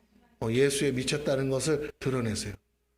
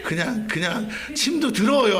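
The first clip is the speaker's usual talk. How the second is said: pleading complaint; 7 semitones higher, 4 dB louder than usual. Retold or imitated.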